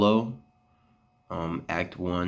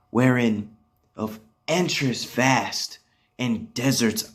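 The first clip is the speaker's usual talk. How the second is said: theatrical Shakespearean recital, higher and louder than conversation; Othello monologue; 5 semitones higher, 6 dB louder than usual. Acted. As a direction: declaim Othello's tale of his wooing, with swelling stresses and measured pauses. Performs Othello's monologue after introducing it.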